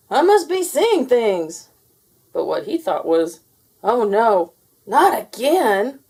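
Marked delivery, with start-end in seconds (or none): 0.1-1.7 high, loud, slow disbelieving voice; astonished man. A narrator's reading. Otis chiming in at the window, high slow disbelief. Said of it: "Otis chimed in". 3.8-6.0 loud, slow groaning lament; a hammed-up weary groan; mild dismay. A narrator's reading. Otis's weary inner thought.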